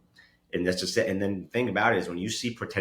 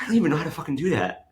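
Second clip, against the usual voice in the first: higher, quick mock protest; retold indignant protest; 7 semitones higher, faster than usual. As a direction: protest your innocence in a raised, hurried voice. Retelling protest at casino accusation.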